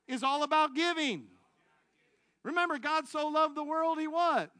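high, loud exhortation with drawn-out phrases; impassioned preaching. Real, not acted. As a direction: rouse the congregation, voice lifted, stretching key words. Preacher leads congregation in the moral.

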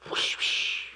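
airy whoosh noises, no voice; breathy whoosh. Pretend. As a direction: blow out two whooshes like wind. Sound of image flying.

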